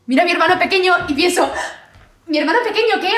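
high, loud burst of excitement; animated outburst. Acted. Monologue, animated outburst about little brother. (arrebato exaltado, agudo y fuerte)

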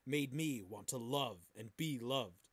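usual speaking voice

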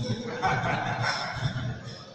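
amused, breathy laughter; real laugh. Real, not acted. Preacher joking about resembling the Prophet, real laugh. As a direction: laugh at your own joke. Laughs at his own joke.

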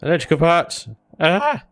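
delighted discovery of "Electrical parts!"; excited. Real, not acted. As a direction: pounce on "Electrical parts!" like a prize find. Delighted at finding loot.

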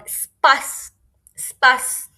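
"স্পাস" loud, ending in a hissed s; eszett sound demo. Shown as a demonstration. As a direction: model "স্পাস" with a long final s. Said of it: "শয়ের মতো উচ্চারণ হবে".

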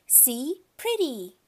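put-on childlike, pleased exclamation; delighted toddler. A narrator's reading. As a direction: show it off like a proud small child. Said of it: "He laughed".

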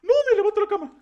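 sudden high yell of alarm; startled shout. Real, not acted. Gamer startled by the monster, high alarmed shout. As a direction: shout it out in real shock.

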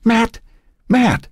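high-pitched call, no real shout; girl calling out. A narrator's reading. Girl calling Matt across the football field, urgent high call. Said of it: "a girl's voice called out".

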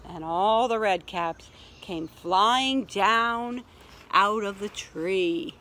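emphatic reading easing into slow, drawn-out phrases; story narration. A narrator's reading. Caps come flying down from the tree, slow storytelling. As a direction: stretch out the climax for little listeners. Reads picture book to children.

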